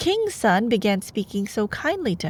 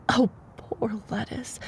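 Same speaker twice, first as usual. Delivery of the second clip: hushed mock sympathy; a soft whispered aside; whispered pity; 5 dB quieter than usual. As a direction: whisper it like a fake condolence. Mock pity aside while reading.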